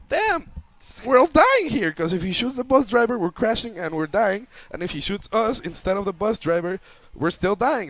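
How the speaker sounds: high, loud frantic panic; scared teen on a robbed bus; panicked thought. Retold or imitated. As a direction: rattle it off in terror.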